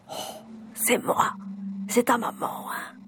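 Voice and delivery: soft, hushed mother's voice; tender whisper. Retold or imitated. Mother to her newly arrived baby, tender whisper. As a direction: whisper it gently, close to a baby.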